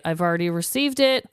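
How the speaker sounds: ordinary talk, nothing marked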